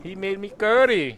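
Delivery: slow, high, loud mock Indian accent. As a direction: crow it in a hammed-up Indian accent. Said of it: "I just did a Vietnamese and a Indian accent".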